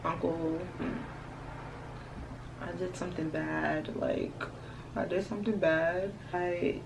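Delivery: soft, small, guilty voice; sheepish confession. Retold or imitated. Confessing to her uncle about the wrong gas, soft guilty voice. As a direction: confess like a kid in trouble. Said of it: "I'm like".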